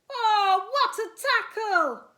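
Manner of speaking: high, loud cheering spiders; thrilled fans yelling at the match; excited cry. Acted. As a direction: cheer the tackle from the ceiling. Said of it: "they cried".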